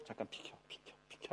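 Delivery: whispered request to make way; hushed aside. Acted. Jesus pushing through the crowd to the beggars, whispering. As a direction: whisper it in short, very soft repeats.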